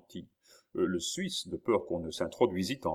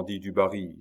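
ordinary delivery, nothing marked

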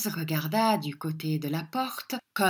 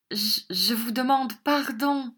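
trembling; 6 semitones higher than usual. A narrator's reading. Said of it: "dit en tremblant".

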